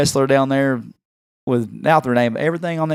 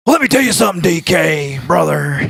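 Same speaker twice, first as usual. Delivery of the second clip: big booming wrestler promo voice, higher and louder; gravelly-free showman catchphrase delivery, pumped up; Hulk Hogan impression; 4 semitones higher, 3 dB louder than usual. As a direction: bellow it like a pro wrestler cutting a promo. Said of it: "And he said".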